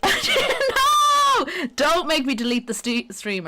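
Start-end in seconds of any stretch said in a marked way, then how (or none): 0.0-1.5 high, loud drawn-out wail; sudden piercing "no"; dramatic wail. Acted. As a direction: cry out way up high in dismay. Sudden cry at streamer mishap.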